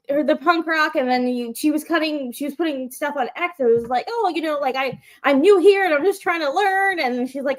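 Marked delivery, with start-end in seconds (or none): 4.1-7.0 higher-pitched, lightly mimicked innocence; cute innocent newcomer. Wrestling fan retelling a newcomer's posts, mock innocent. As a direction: imitate a plucky little newcomer, pitched up. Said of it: "this cute little innocent thing".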